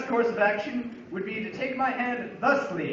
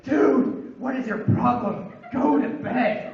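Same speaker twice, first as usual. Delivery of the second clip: higher, louder, irritated yell at a roommate, the last words slowed; annoyed yell; 5 semitones higher, 5 dB louder, slower than usual. Retold or imitated. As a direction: tell the kid off, fed up. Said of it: "I'm really ticked off at this point".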